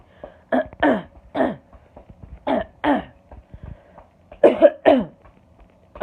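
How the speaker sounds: long, loud racking coughing fit; can't stop coughing. Real, not acted. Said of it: "Oh, this is what I mean".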